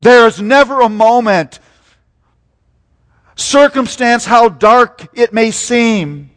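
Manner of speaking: loud, high preaching build; rising pulpit fervour, loud and high; full-force preaching. Real, not acted. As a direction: lift it up and preach it out. Preaching climax.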